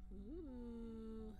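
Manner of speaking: usual speaking voice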